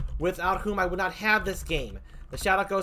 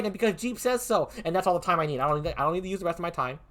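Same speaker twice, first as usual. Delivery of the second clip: ordinary talk, nothing marked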